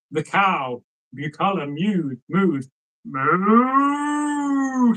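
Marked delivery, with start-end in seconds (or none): 3.0-4.9 long moo, pitched higher; a drawn-out sung moo; cow's moo. A narrator's reading. The cow answering the lad with a high moo. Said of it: "said the cow".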